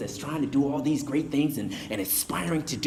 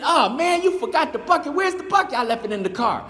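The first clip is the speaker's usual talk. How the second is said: high, teasing disbelief; high-pitched friendly scolding; mock exasperation; 9 semitones higher than usual. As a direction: rib your buddy for forgetting it. Friend teasing about forgotten bucket.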